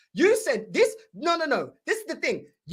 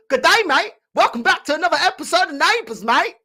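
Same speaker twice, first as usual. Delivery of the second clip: loud, high mock Aussie presenter; Australian mock accent; 4 semitones higher, 4 dB louder than usual. Mocking the manager as a Neighbours host, hammed-up Australian accent. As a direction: play it like a soap opera host from Down Under. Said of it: "deserves to be on Neighbours".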